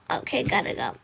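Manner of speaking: soft, unvoiced quick sign-off; hurried whisper. Real, not acted. Vlogger cutting off her story, quick whisper. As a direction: whisper so nobody hears. Suddenly ends the recording.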